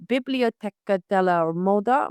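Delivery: slow, careful "Biblioteca della Moda" in Italian; attempted Italian accent. Shown as a demonstration. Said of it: "My Italian is awful".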